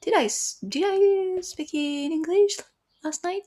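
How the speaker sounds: high, incredulous self-questioning; waking up puzzled. Retold or imitated. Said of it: "I woke up and I was like".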